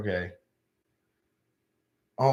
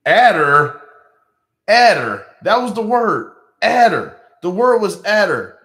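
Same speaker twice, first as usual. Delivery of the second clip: loud, high disbelief at the answer; stunned yell, pitch jumping up; shocked outburst; 6 semitones higher, 7 dB louder than usual. Real, not acted. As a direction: shout it out in outrage.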